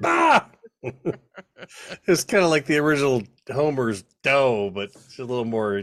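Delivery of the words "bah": loud, high-pitched imitation bark; mock shout. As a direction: echo the catchphrase in a hammed-up yell. Co-host echoes the shouted catchphrase.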